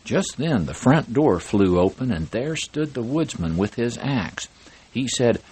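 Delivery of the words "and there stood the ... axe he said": ordinary delivery, nothing marked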